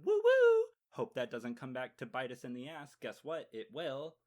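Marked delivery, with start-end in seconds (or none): 0.0-0.6 high, loud whooping siren; mock siren wail; car alarm imitation. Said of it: "still making the little alarm".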